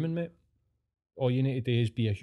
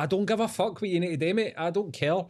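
higher-pitched, impatient comeback; retold exasperation; 7 semitones higher than usual. Telling the decorator off in retelling, exasperated. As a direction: cut him off, fed up, in a raised pitch.

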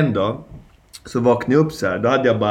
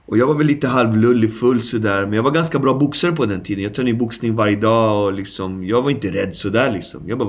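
ordinary delivery

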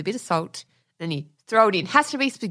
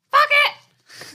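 high, loud "screw it" burst; exclaimed outburst; 6 dB louder than usual. Retold or imitated. Telling husband how she decided to cook, high exclaimed outburst. Acting out her fed-up decision.

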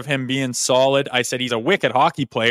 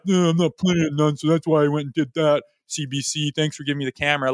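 mock sulky player. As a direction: play it like a snide complaint to the cameras. Mocking the player's sulky complaint.

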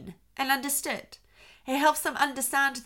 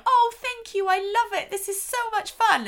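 mock delighted kid, much higher and louder; excited child; 10 semitones higher, 4 dB louder than usual. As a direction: gush like a thrilled child. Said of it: "one of your children is going".

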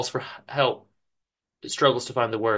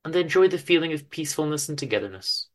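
ordinary delivery

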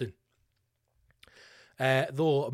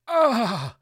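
loud, high "ah" of disgust; disgusted groan; 7 semitones higher, 10 dB louder than usual. Acted. Shows disgust at desserts.

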